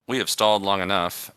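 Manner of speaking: ordinary talk, nothing marked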